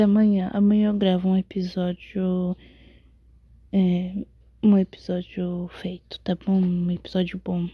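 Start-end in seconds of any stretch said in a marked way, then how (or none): 4.6-7.7 whispering. Real, not acted. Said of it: "eu tô sussurrando porque eu tô com sono".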